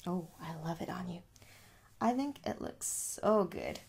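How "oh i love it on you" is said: usual speaking voice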